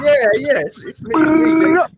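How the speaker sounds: high, loud, giggling quote of the boxer; high, loud, laughing retelling. Retold or imitated. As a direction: squeal it out through laughter. Boxer confirms identity, teller laughing.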